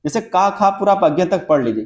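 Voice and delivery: normal talking voice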